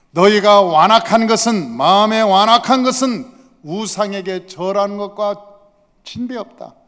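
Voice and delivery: stern condemnation building to loud; angry rebuke. Acted. Samuel condemns Saul's stubborn heart, angry. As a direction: build from firm reproach to forceful denunciation. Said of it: "이렇게 화를 내서 얘기하는".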